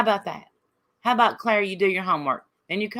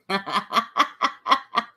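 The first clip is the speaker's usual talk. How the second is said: light, airy laugh; 2 semitones higher than usual. Real, not acted. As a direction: laugh warmly. Laughs at chat.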